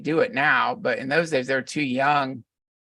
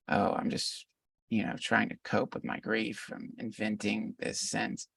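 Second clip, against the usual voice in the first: mocking sceptic; 4 semitones lower, 9 dB quieter than usual. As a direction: breathe it out like a dismissive sceptic.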